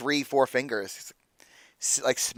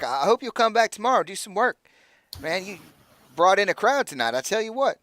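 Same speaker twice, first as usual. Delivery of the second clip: put-on higher, jovial good-ol'-boy voice; pitched-up friendly NPC, sorry to see him go; Aldo, higher; 3 semitones higher than usual. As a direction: coax him to stay, full of praise.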